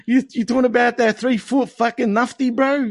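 high, worked-up comic disbelief; mock outrage. Mock outraged bit continues.

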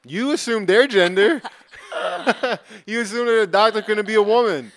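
high, loud mock indignation; mock outrage. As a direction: scold him in jest, loudly.

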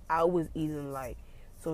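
usual speaking voice

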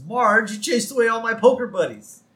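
high-pitched upset complaint; upset Homer. Retold or imitated. Husband to wife who scared off his buddies, upset. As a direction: whine it at your wife, put out. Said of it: "And Homer's upset".

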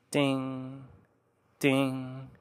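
quiet, ringing "ding, ding"; soft bell imitation. A narrator's reading. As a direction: ring out "ding" softly like a bell.

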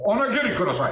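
manly growl. Retold or imitated. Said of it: "guys always have to growl when they talk".

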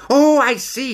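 high, loud burst of understanding; excited realisation. Acted. Stoat finally grasping the question, high and excited. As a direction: leap on it, pitch way up.

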